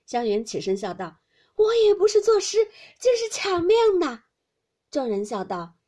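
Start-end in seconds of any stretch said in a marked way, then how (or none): 1.6-4.2 high, very loud outcry; exclaimed playful protest. A narrator's reading. Xiangyun getting up after the frantic verse contest, exclaimed playful protest. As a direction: protest loudly, in high excitement.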